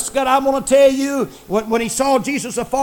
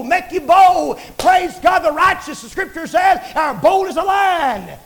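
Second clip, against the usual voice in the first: rising, louder revival preaching; fervent preaching; 6 dB louder than usual. Acted. Preacher stirring up the radio congregation, fervent preaching.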